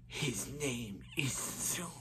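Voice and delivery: hushed, slow villain voice; very soft, slow menacing figure; Zoom voice. Acted. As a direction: draw it out softly like a sinister villain. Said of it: "I do a very good Zoom voice".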